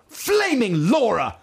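high, drawn-out cry of exasperation; hammed-up upset manager, slow and high; mock exasperated shout. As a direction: stretch it out in put-on outrage. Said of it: "also used to shout".

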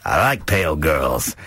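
mildly creepy, swooping relish; creepy admirer. Real, not acted. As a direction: let it sound a touch creepy. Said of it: "that sounds quite creepy".

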